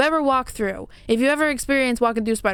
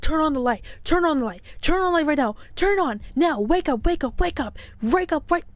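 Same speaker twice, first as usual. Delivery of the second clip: higher, urgent re-enacted pleading to wake up; retold panic; 5 semitones higher than usual. Woman begging sleeping partner for light, in retold panic. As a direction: act out frantic, repeated demands, pitched up. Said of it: "anybody who would be panicking that much".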